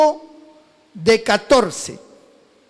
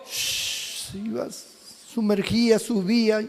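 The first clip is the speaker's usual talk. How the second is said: hushed; 4 semitones lower, 6 dB quieter than usual. Acted. Fish diving under the sea.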